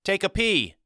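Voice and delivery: put-on high-pitched boy's shout; shouted reply. A narrator's reading. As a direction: yell it back over your shoulder, pitch way up. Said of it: "I shouted".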